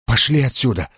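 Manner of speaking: ordinary delivery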